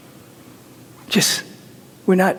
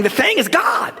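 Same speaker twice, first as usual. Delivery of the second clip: voice going up. Real, not acted. Preacher to congregation, voice soaring higher. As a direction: climb up into the high register. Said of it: "My voice is going up".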